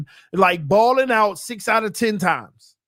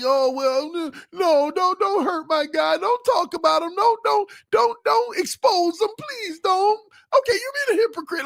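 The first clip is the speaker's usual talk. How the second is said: high, pleading, whiny fan voice rising higher; mock crying fan; 8 semitones higher than usual. A streamer mocks fans begging him to spare their quarterback, high whiny pleading. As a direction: whimper like a fan protecting his guy. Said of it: "as if you're just crying like".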